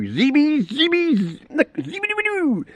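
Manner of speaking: high sing-song pet voice; sing-song cooing to a pet cat. Real, not acted. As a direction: coo the cat's name up high.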